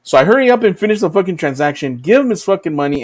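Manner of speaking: ordinary talk, nothing marked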